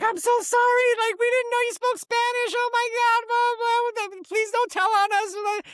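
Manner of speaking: very high, hammed-up pleading; panicked apology. Haircutter begging customer not to tell, high mock panic. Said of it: "about to start apologizing".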